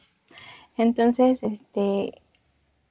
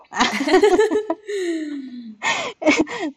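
long giggly laugh, higher; embarrassed laugh; 7 semitones higher than usual. Real, not acted. Laughing as she confesses to the host she fell in love again. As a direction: laugh at her own crush. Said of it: "me enamoré de nuevo".

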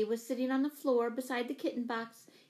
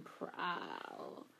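low, soft cat trill; imitated reassuring cat sound; cat's purring meow; 11 dB quieter than usual. A narrator's reading. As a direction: roll out a very low, quiet cat call.

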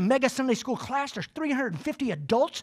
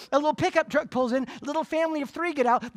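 normal talking voice

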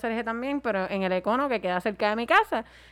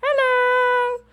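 dramatic hello; 13 semitones higher, 5 dB louder than usual. Pretend. Repeating dramatic greeting.

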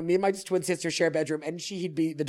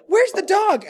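calling out; 13 semitones higher, 8 dB louder, slower than usual. Retold or imitated. Calling for the dog.

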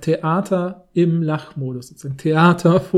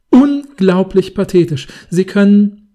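loud, slow scornful verdict; loud critic; 2 semitones higher, 8 dB louder, slower than usual. Pretend. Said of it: "in der Art vorzulesen".

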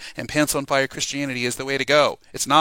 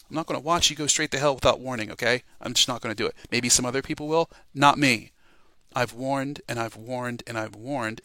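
ordinary talk

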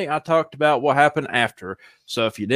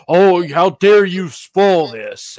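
slow, whiny mock outrage; imitating a fan outraged over spoilers; mock complainer; 4 semitones higher, 4 dB louder, much slower than usual. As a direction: ham up an offended fan. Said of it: "will complain and be like".

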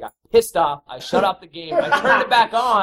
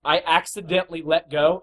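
usual speaking voice

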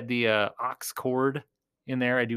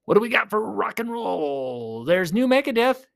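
usual speaking voice

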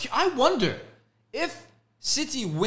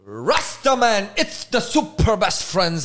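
slow, louder fake Jamaican accent; mock Jamaican accent; 3 semitones higher, 4 dB louder, slower than usual. Podcast host opening the show in a fake Jamaican accent. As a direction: lay on a bad Jamaican accent. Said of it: "I'm gonna talk a little Jamaican".

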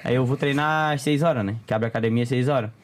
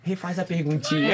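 imitating the mayor; 3 semitones higher than usual. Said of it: "ele veio assim pra mim, ó".

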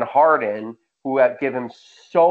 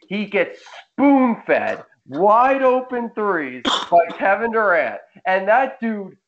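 higher, mock-anguished groan over a bad player; exasperated rant; 6 semitones higher than usual. Real, not acted. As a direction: whine it out like the miss hurts you personally. Roasting Joe Harris, exasperated rant.

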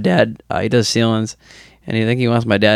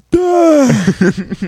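mock dopey high grunt; a goofy, dazed exclamation; 14 semitones higher, 4 dB louder than usual. Acting out his drunk wiffle ball swing, dopey high grunt.

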